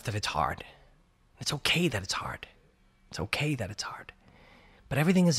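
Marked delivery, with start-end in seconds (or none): 1.4-2.5 soft whispered reassurance; whispering. Real, not acted. Repeated reassurance to self. 3.1-4.1 fainter whisper, almost to himself; whispering, softer. Real, not acted. Repeated reassurance to self.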